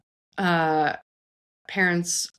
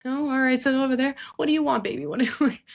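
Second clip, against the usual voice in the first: higher, sing-song coo to a little one; 8 semitones higher, faster than usual. Real, not acted. Mother cooing at her baby, higher and sweet.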